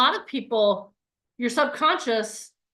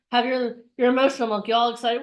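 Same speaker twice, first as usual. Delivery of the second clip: normal talking voice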